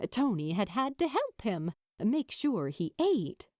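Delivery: ordinary delivery, nothing marked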